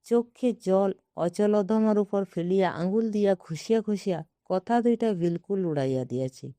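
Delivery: plain reading voice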